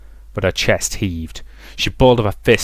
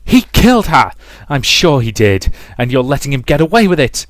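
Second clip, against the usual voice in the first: loud, high, furious shouting; screaming accusation; 5 semitones higher, 8 dB louder than usual. A narrator's reading. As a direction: scream the charge at her. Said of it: "Mother was screaming now".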